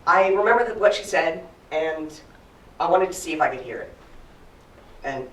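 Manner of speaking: ordinary delivery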